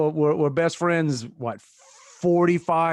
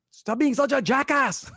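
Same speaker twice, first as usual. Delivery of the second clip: put-on higher, laughing Mexican-accented priest; Nacho Libre accent; 9 semitones higher than usual. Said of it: "All my accents are Nacho Libre these days".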